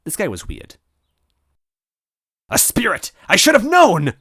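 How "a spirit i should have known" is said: high, loud robot realization; robot crying out. Acted. Robot villain spotting the ghost, crying out. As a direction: cry out in triumph. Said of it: "Yarodovic cried".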